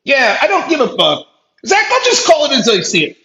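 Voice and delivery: normal talking voice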